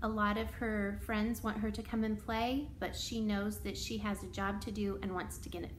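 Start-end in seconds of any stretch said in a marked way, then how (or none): none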